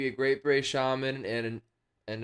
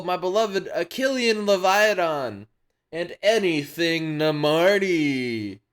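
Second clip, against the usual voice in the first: raised, slow, grand announcer; big game-show host delivery; 5 semitones higher, 5 dB louder, slower than usual. Pretend. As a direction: announce it with drawn-out showmanship. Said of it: "Get back to announcer voice [name]".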